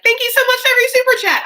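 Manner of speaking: mock-sweet streamer thanking her donors; hammed-up high thank-you; gushing thank-you voice. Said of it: "and being like".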